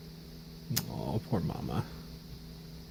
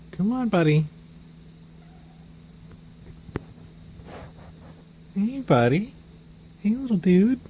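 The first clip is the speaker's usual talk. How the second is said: coaxing pet talk; 9 semitones higher than usual. Real, not acted. Owner coaxes the kitten to come over, high sing-song pet talk. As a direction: call the kitten over, bright and eager.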